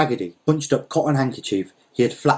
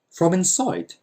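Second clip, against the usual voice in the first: high, puzzled question; surprised; 7 semitones higher, slower than usual. A narrator's reading. Farmhand puzzled by the mender inside the jar, high and surprised. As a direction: ask in disbelief.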